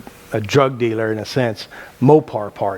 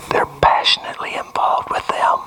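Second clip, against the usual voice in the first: breathy secret whisper on passionately; hushed whisper, stretching passionately. Shown as a demonstration. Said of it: "Did you hear what I said?".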